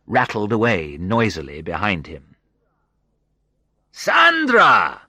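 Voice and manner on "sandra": a big, high, loud call of her name; joyful cry. A narrator's reading. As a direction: call out across the room in delight. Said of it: "he cried".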